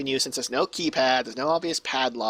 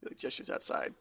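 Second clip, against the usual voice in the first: ordinary talk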